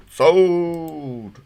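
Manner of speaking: loud, high, drawn-out sold; loud high shout of delight; excited shout. Real, not acted. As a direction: call it like an auctioneer. Excited over glasses.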